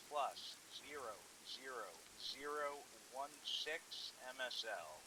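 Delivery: measured, deliberate radio voice; slow radio readout. Acted. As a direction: call out each digit slowly over the radio. Radio grid call.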